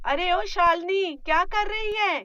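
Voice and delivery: loud, high call across the house. Acted. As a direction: shout for the bride from the next room.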